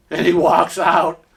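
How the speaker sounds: normal talking voice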